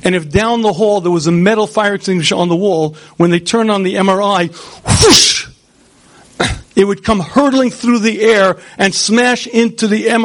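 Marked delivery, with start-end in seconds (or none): 4.5-5.5 whoosh sound. Shown as a demonstration. Fire extinguisher flying to magnet.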